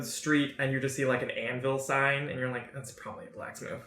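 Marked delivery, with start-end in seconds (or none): 2.7-3.8 very soft, low, barely voiced; muttered thought. Game master voices the players' passing thought, muttered. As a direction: murmur it as an idle thought.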